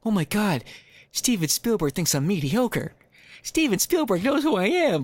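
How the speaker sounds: high, giddy fangirl squeal; excited. Pretend. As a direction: gush like a starstruck fan. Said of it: "gushing fan reaction".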